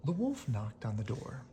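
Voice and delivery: ordinary delivery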